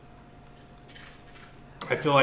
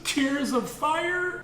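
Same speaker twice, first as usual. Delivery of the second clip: mock crying; 14 semitones higher, much slower than usual. Mock crying over chopping peppers.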